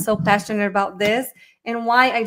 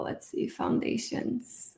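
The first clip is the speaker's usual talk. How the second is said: slow whisper to herself, not to the audience; murmured self-talk, slow and hushed; whispered aside; 2 semitones higher, 10 dB quieter, slower than usual. Real, not acted. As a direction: breathe it under your breath while searching the screen. Muttering to herself while searching.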